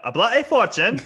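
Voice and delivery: laughing, rising, a hint of British; bad British accent through a laugh; mock British accent. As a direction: tease the word bloody in a put-on British accent. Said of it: "you hear you do your accents".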